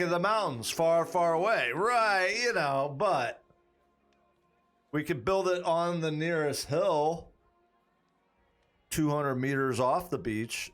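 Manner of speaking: ordinary talk, nothing marked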